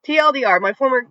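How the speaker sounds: ordinary delivery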